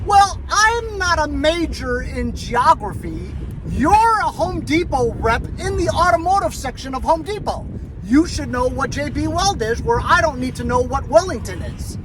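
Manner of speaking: high-pitched mock indignation; indignant comeback. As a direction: act out telling off a useless store clerk. Acted indignant comeback to clerk.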